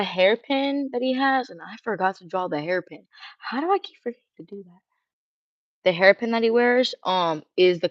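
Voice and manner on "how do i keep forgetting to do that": fast muttered self-scold. Real, not acted.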